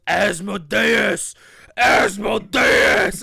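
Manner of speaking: loud, drawn-out menacing roar; hammed-up villain snake shout, stretched out; monstrous snake cry. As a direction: bellow it slowly like a giant serpent.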